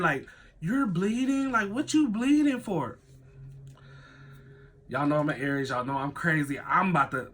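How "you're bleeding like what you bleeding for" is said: higher, put-on questioning voice; weird-acting cousin. Cousin sees speaker bleeding, high-pitched weird questioning. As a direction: imitate the cousin asking, pitched up. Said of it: "My cousin acting all weird".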